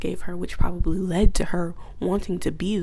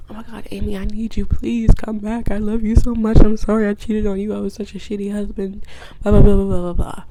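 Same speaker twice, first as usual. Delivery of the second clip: mock pleading; 2 semitones higher, 5 dB louder than usual. Cheating husband begging his wife to return, mock pleading. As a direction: plead with exaggerated remorse.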